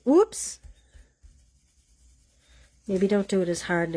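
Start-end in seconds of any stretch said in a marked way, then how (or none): 0.0-0.6 surprised whoops. Real, not acted. Slip while wiping ink.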